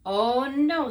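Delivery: loud dismayed oh no; exasperated no. A narrator's reading. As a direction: groan it out loudly. Father refuses.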